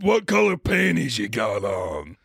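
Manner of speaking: slow lecherous shout; Vin Diesel creep. Retold or imitated. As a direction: holler it slowly like a sleazy flirt. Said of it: "he's like".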